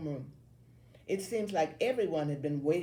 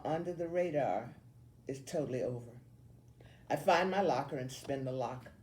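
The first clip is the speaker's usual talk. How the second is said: ordinary delivery, nothing marked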